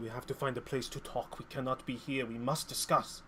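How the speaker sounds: quiet insistence; insistent hush. Acted. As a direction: keep pressing to talk privately. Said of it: "Lo Su keeps just saying".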